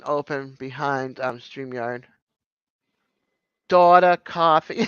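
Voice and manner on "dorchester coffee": dropped-r Boston vowels; Boston accent. Real, not acted. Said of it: "my Boston accent is coming out tonight".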